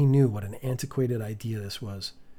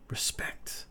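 whispered; 9 dB quieter than usual. A narrator's reading. Narrator's hushed one-word aside.

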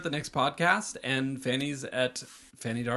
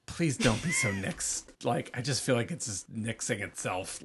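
ordinary talk, nothing marked

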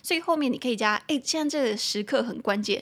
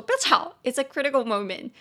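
mimicking friend; 4 semitones higher than usual. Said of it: "他就叫我说".

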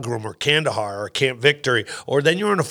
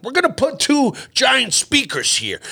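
higher, emphatic mock-general orders; commanding general; 11 semitones higher than usual. General telling terrified comics about the show, mock commanding. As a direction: lay out the plan like a gung-ho general. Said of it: "the general was like".

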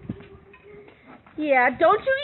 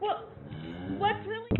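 ordinary talk

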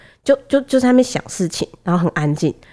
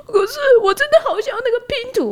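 put-on high whimpering kid voice; crying child. Toddler begs mom through tears, high voice. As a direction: plead like a teary toddler.

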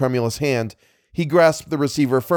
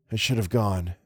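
quiet regret; 5 dB quieter than usual. Acted. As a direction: let the regret drop to near a murmur. Regretful soft repeat.

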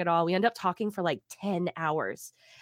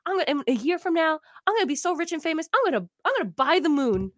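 much higher and louder, gushing dreams; giddy excitement; 7 semitones higher, 3 dB louder than usual. Retold or imitated. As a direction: bubble over with wild hopes. Retold giddy dreams of fame.

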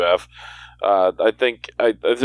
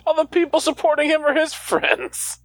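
mocking high voice. Mock echo of co-host's claim.

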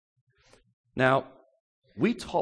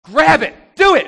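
loud, high jeering push; devil's taunt; 10 semitones higher, 15 dB louder than usual. Retold or imitated. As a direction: goad him hard to grab it. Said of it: "we see the devil saying to him".

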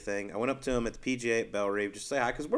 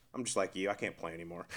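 low mumbling Duff; 7 semitones lower, 12 dB quieter than usual. Retold or imitated. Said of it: "He goes".

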